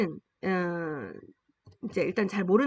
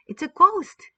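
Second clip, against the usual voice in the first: a lightly acted scared cry, louder and higher; frightened cry; 8 semitones higher, 8 dB louder, much slower than usual. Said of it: "they said and cried out in fear".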